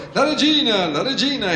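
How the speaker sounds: high-pitched alarmed cry; anxious cry. A narrator's reading. Gardener warns the others of the queen, anxious cry. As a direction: cry out a warning, full of anxiety. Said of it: "pieno d'ansia, gridò".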